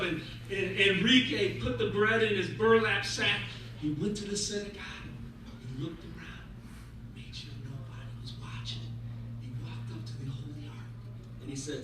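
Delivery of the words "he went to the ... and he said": soft, low, secretive narration; hushed storytelling. A narrator's reading. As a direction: tell it quietly, as if sneaking in.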